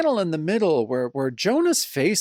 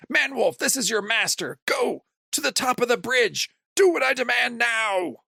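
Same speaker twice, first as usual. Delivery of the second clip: villain commanding; 8 semitones higher than usual. Retold or imitated. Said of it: "He says".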